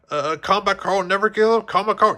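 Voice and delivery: high, unsure try at the motto; hesitant attempt. A narrator's reading. Jessie hesitantly repeating the soldier's motto. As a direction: try the words without belief. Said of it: "Jessie tried".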